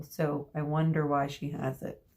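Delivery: usual speaking voice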